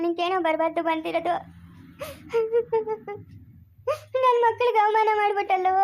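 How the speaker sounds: wailing lament broken by sobs; crying. Acted. Family member cursing the man who shamed them all, crying. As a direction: sob out each reproach in a long, drawn wail. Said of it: "ಅಳ್ಬೇಡ".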